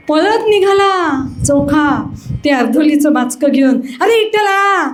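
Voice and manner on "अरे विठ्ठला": high, urgent calling out after someone running off. Acted. Chokha running after Vitthal with his sack, calling out high. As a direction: call his name higher and higher to stop him.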